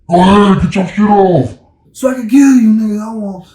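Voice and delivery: loud hammed-up cop threat; mock cop taunt. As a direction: shout the dare like a menacing cop.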